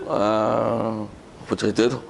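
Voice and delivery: ordinary talk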